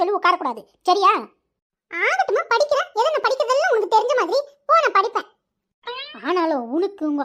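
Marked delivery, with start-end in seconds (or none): 1.9-5.3 put-on boy sulkily promising his mother to study; sulky child. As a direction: talk back like a sulking schoolboy.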